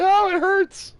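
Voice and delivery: very high, loud mock whimper; shrill falsetto ouch, hammed up; mock squeal of pain. Imitating a woman in bed complaining, squealing.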